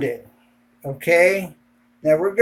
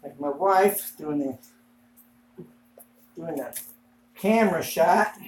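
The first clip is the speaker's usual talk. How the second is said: muffled behind a respirator mask; through a respirator mask; 6 dB quieter than usual. Real, not acted. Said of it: "Gotta get a respirator on".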